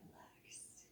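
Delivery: barely voiced relax on the out-breath; soft whisper as the class exhales; whispered cue. Shown as a demonstration.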